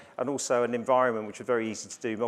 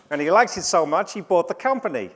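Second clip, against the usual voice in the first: a quick, louder put-on American accent; a bad American accent, louder and higher; Victor Kiam's American accent; 7 semitones higher, 8 dB louder than usual. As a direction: mimic the razor ad's American pitchman, hammed-up. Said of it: "Shall I do the accent?".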